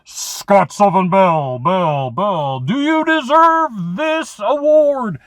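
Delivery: high, slow, booming cosmic judge calling his name; universe voice. Retold or imitated. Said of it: "the universe is like".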